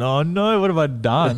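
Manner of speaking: high, panicked "oh no" as a retold fright; mock panic. Remembering the missed call from Fitzy, mock panic. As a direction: play up the sudden dread, pitched well up.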